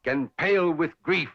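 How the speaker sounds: slow, declaimed romantic verse; Shakespeare love speech. Acted. Suitor reciting Shakespeare to an admirer, slow declamation. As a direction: recite it grandly, drawing out each phrase. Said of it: "love speeches from Shakespeare".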